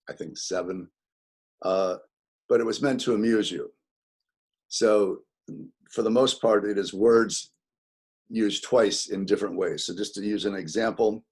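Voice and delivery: ordinary delivery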